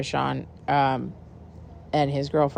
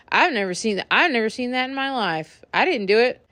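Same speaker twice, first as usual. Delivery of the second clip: high, mock-innocent drunk denial; 8 semitones higher than usual. Drunk T Y denying it with pizza in hand, mock innocence. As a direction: play dumb, a little higher. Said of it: "is just like".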